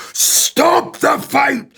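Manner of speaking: drawn-out, high-pitched scream; full-force scream. Shown as a demonstration. Actor screaming a film line to get into character, full-force drawn-out scream. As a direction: scream each word long, high and loud. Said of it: "I would scream that".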